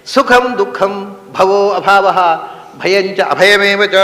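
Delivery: slow Sanskrit chant-like recitation; slow scripture recitation; verse recitation. Shown as a demonstration. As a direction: recite the shloka slowly and evenly.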